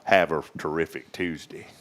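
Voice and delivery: quiet drawled Southern greeting; country twang. Shown as a demonstration. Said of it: "you gotta get that twang down in there".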